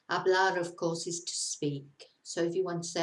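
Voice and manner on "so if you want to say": ordinary talk, nothing marked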